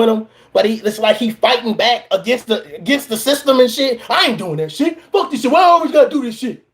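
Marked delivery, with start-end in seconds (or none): 4.1-6.6 hard-headed soldier. Retold or imitated. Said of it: "he hard-headed".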